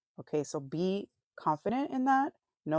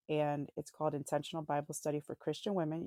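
usual speaking voice

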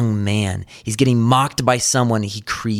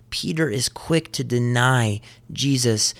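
usual speaking voice

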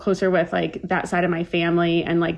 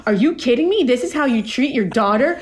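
higher, indignant, hammed-up complaint; mock outrage; 4 semitones higher than usual. Daughter mock-scolding her mom over frozen lasagna. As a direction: play the wronged daughter for laughs.

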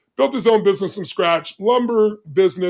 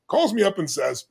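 usual speaking voice

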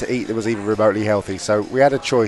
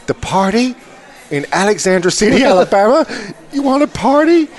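much higher, rising to louder disbelief; incredulous waitress; 9 semitones higher, 4 dB louder than usual. Retold or imitated. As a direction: scoff at the idea of a party here. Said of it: "she just leads and goes".

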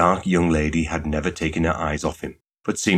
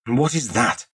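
higher-pitched impatient question; 6 semitones higher than usual. A narrator's reading. Shop girl questions suitor about the sugar mountain, impatient. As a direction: demand an answer impatiently. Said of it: "she asked impatiently".

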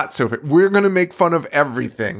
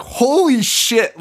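shocked exclaim; 11 semitones higher, much slower than usual. Retold or imitated. Retold shock reaction.

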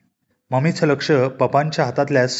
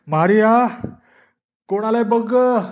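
calling out; 8 semitones higher than usual. A narrator's reading. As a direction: shout for the maid to get the door. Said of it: "मारियाला ओरडून सांगितलं".

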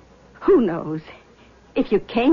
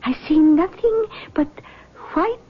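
lightly voiced mystic, higher pitch; seance medium; 7 semitones higher than usual. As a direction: greet them like a gracious clairvoyant. Medium reads the spirit.

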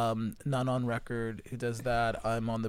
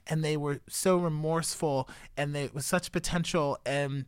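ordinary delivery

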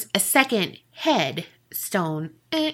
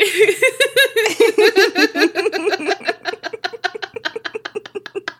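long laugh; 11 semitones higher than usual. Real, not acted. Both crack up at the accent.

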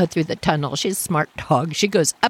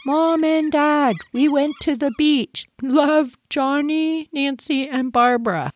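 put-on childish note read; 7 semitones higher than usual. Reading the note left for parents.

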